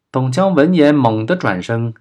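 usual speaking voice

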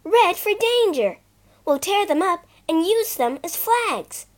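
Bobby urging the petticoat flags; eager. Acted. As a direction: explain it breathlessly. Bobby explaining her plan.

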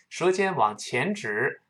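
usual speaking voice

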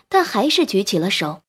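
usual speaking voice

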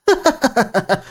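high, crazed villainess laugh; manic laugh. A narrator's reading. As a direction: cackle like she's lost her mind. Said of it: "好似陷入癫狂".

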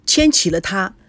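ordinary delivery

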